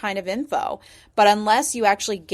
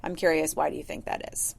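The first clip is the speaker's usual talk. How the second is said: whispered, soft and gentle; hushed curious question; 3 semitones lower, 6 dB quieter than usual. Shown as a demonstration. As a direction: ask it softly, curious not accusing. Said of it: "in a way that feels much more gentle".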